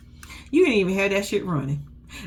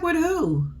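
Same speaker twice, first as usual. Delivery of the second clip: incredulous question; 8 semitones higher than usual. Retold or imitated. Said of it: "She's like".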